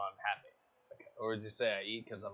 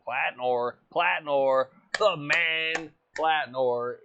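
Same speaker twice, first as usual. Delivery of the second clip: loud, higher hype call of the driver; hyped name call; 5 semitones higher, 14 dB louder, much slower than usual. Real, not acted. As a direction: call his name like a ring announcer.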